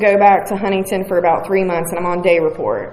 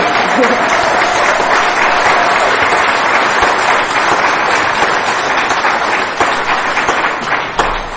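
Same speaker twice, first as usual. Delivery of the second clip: long unvoiced laugh of relief; real happy laughter; long breathy laugh; 3 semitones higher than usual. Real, not acted. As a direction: let a long airy laugh roll out. Long laugh after clean date.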